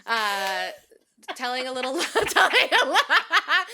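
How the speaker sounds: high, loud, laughing through the words; cracking up mid-pitch; laughing mock announcer. Host giggling while hyping Tracy the butcher.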